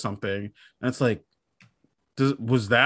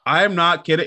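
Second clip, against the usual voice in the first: normal talking voice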